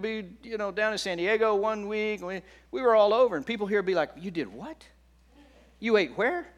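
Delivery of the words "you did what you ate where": imitating astonished locals, pitch jumping on the question; mock incredulous. People here react to the newcomers' outings, mock incredulous. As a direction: act out disbelief at their outings.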